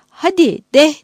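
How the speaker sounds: loud, high call to animals; a sharp urging-on cry; urging the mules. A narrator's reading.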